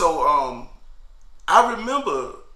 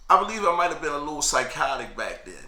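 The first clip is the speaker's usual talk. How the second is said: ordinary talk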